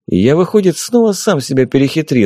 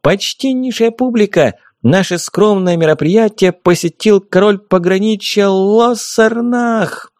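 booming circus ringmaster; 9 semitones higher than usual. A narrator's reading. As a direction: announce the king to the crowd. Fairy announces the king.

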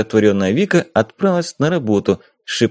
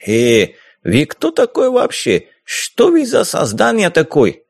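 put-on hot-tempered corsair in a thick Caucasian accent, higher; mocking Caucasian-accented pirate, raised pitch; 5 semitones higher, slower than usual. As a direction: brush her off with a swaggering Caucasian lilt. Corsair confronts the artist.

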